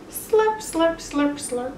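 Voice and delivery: high, drawn-out slurp sounds; slurping noise. Acted. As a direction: slurp it up like a greedy bear. Bear eating pizza noisily.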